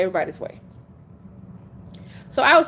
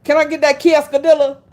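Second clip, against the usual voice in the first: loud, confident botched order; said with their chest; 4 semitones higher, 8 dB louder than usual. Retold or imitated. Customer ordering at a restaurant, loudly and proudly mispronouncing. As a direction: belt out the mangled word with total confidence. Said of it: "they said it with their chest".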